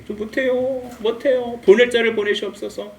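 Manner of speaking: high, put-on pleading Moses; hammed-up reluctant protest; pleading refusal. Moses pleading to refuse God's mission.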